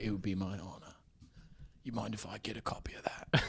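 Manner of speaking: soft, near-whispered reply; hushed Statham voice. Retold or imitated. Jason Statham thrilled to take a fan photo. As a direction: keep it breathy and low-key.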